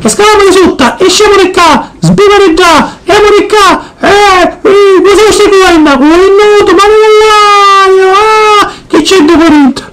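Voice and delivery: put-on high, thrilled kid; excited child voice. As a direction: squeal with delight like a child. Child voice at the beach.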